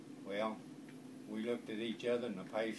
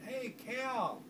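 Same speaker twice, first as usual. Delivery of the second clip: calling mother voice; 13 semitones higher, 3 dB louder than usual. Retold or imitated.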